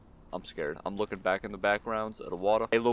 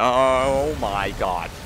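drawn-out oh my god; 7 dB louder, much slower than usual. Real, not acted. Horror moment, drawn out.

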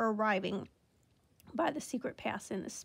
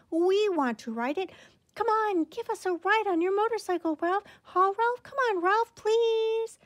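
very high, loud pleading squeaks; squeaky begging cousins; 10 semitones higher, 6 dB louder than usual. A narrator's reading. Little cousins begging Ralph for a ride, high squeaky whine. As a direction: whine and beg like tiny mice. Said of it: "they squeaked".

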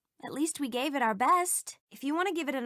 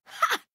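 sharp taunting ha. Acted. Taunting game voice line.

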